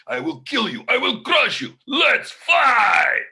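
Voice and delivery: high, loud Russian brute building to a shout; Russian killer voice. Acted. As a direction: threaten like a big Russian fighter, then shout. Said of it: "he has a voice like this".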